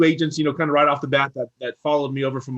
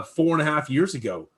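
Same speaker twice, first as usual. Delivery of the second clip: ordinary talk, nothing marked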